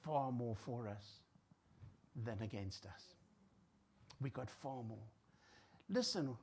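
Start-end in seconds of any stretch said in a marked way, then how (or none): 0.0-5.1 hushed declaration. Real, not acted. Quoting scripture, hushed.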